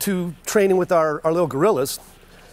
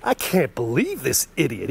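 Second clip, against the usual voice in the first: snake's baffled thought; 2 semitones lower than usual. Retold or imitated. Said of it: "this snake is looking at him saying".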